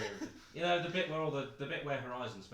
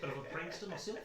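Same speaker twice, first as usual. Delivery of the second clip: put-on light New Zealand accent; 7 semitones higher than usual. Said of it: "I can't stop doing a New Zealand accent".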